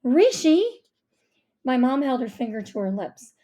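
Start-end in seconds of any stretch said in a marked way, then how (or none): 0.0-0.6 loud, high call of a name; exasperated scolding. A narrator's reading. Mom silencing her guessing son, exasperated. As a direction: cut him off sharply.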